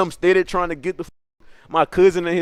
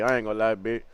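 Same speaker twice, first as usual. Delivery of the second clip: ordinary delivery, nothing marked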